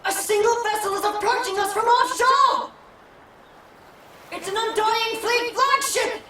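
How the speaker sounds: urgent lookout cry; urgent report. Acted. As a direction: warn the captain at once. Lookout reports enemy ship.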